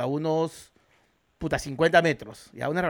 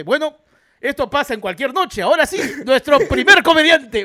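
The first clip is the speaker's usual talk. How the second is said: higher, louder mock announcer; show presenter; 6 semitones higher, 9 dB louder than usual. Presenter brushes off the fight and introduces the comedian, show presenter. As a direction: build to a big, loud introduction.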